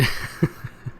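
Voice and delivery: low-key voiceless chuckle; short laugh. Real, not acted. Reader amused by the routine, short chuckle. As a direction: chuckle to yourself.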